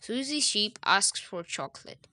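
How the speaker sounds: ordinary delivery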